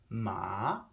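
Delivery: drawn "ma" with a late rise; Chinese rising tone. Shown as a demonstration. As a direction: let "ma" climb steeply at the end. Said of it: "the Chinese language is very much tonal".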